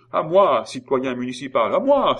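very high, very loud panicked call; crying for help. Acted. Simon begging the municipal for help, a loud high panicked cry. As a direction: scream for help, pitch soaring. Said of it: "s'écria Simon".

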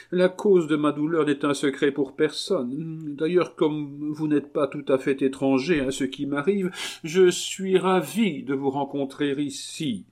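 high aggrieved marquise. Acted. Marquise answering the abbé, high and plaintive. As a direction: play a high-voiced noblewoman in grief.

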